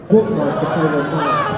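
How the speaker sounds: laughing through the words; laughing callback. Acted. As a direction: chuckle out the old punchline "bookmark". Callback repeated while laughing.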